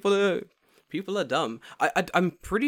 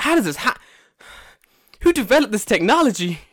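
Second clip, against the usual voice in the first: astonished; 8 semitones higher, 5 dB louder than usual. Retold or imitated. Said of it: "You're like".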